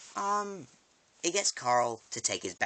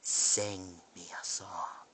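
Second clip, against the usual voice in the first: menacing Negan; 4 dB quieter, much slower than usual. Retold or imitated. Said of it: "points it at him and goes".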